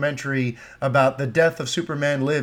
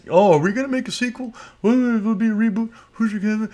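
higher, quick mock-dithering executive voice; mocking studio executives; 6 semitones higher than usual. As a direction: parody the studio's endless indecision.

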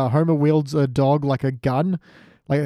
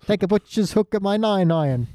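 higher, badly faked Cockney slang; Cockney accent; 5 semitones higher than usual. Co-host who doesn't know Cockney, faking the accent. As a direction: attempt a clumsy cartoonish Cockney. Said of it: "I don't know Cockney".

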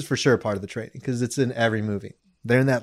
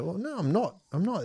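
put-on soft, higher British-accented denial; fake British accent; 6 semitones higher, 8 dB quieter than usual. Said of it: "you're faking it".

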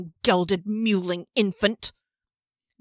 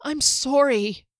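slow, tight-lipped, higher apology; squeezed out apology; 6 semitones higher, 3 dB louder, slower than usual. Acted. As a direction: force the words out past taut lips. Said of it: "The words squeezed out between taut lips".

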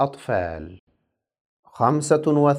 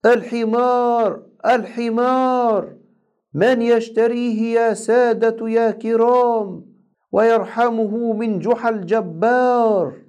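street vendor call; 8 semitones higher than usual. A narrator's reading. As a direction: call out the donkey for sale to the crowd. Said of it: "وراح ينادي قائلا".

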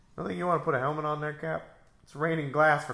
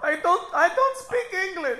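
high, loud put-on foreign lady; mock lady voice; 7 dB louder than usual. Voicing the lady replying to the hero, high and put-on. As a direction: play the flustered lady who can't understand.

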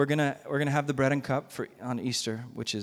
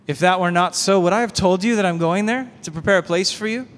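firm, louder scripture reading; preaching scripture; 5 dB louder than usual. Acted. Pastor reading Jesus' comfort to the congregation, firm and loud. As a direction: proclaim the promise to the church. Reads Jesus' words with force.

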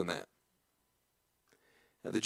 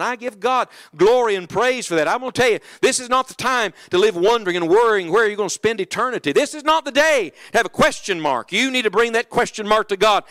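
louder, rising sermon delivery; full-force preaching; 4 semitones higher, 5 dB louder than usual. Acted. Preacher urging listeners to settle their salvation, preaching hard. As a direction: press the altar call with fervour.

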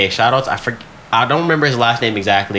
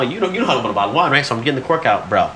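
normal talking voice

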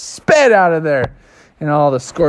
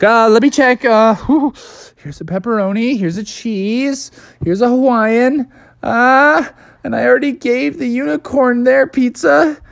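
nervous stalling; 8 semitones higher than usual. Acted. Bubby stalls, checks the pizzas.

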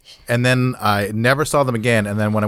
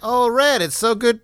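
excited greeting; 9 semitones higher than usual. Acted. Greeting friend in character.

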